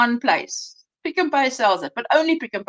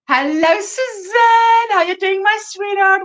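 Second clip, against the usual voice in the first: excited greeting; 7 semitones higher, 5 dB louder, slower than usual. Real, not acted. Greeting a favourite viewer.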